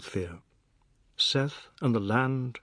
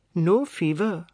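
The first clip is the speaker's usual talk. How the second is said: Sharma, brisk; 6 semitones higher, 5 dB louder, slower than usual. A narrator's reading. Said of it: "she said".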